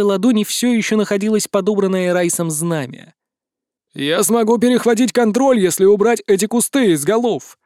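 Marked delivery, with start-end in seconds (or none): 3.9-7.6 shouting. A narrator's reading. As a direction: yell it across the battle. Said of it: "кричал из-под купола Ларри". (громкий крик)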